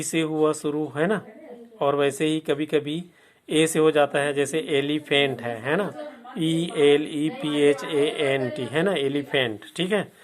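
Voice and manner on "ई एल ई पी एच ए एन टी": very slow letter-by-letter spelling; teacher spelling a word for students; spelling aloud. Shown as a demonstration. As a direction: spell each letter out slowly. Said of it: "spelling out elephant".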